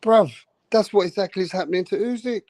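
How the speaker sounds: ordinary delivery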